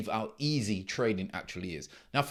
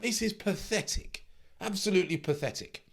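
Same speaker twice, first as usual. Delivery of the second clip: high, scornful "pathetic"; scornful disbelief; 8 semitones higher than usual. Real, not acted. Coach scoffing at a trader who quit early, high and scornful. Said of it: "getting a little bit, you know, emotional".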